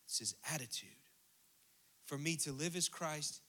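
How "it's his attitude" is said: hushed whispered aside. Real, not acted. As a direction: drop to a secret whisper.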